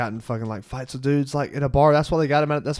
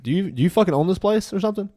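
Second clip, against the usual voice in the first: higher, louder imitated angry challenge; mad retort; 8 semitones higher, 5 dB louder than usual. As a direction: demand it like you own the fight. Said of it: "Sean is so mad".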